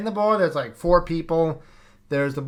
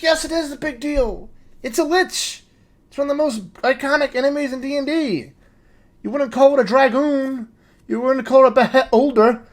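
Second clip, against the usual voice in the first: indignant nerd rant; 8 semitones higher, 5 dB louder than usual. Retold or imitated. Ranting at DM over lich.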